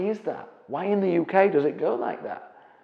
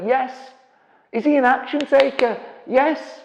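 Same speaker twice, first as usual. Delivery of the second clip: usual speaking voice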